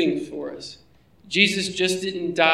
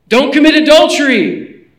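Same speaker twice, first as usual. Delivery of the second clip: high, loud proclamation; loud, high preaching cry; booming commandment; 8 semitones higher, 10 dB louder than usual. Acted. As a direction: thunder the commandment at the congregation. Preacher proclaims commandment at full force.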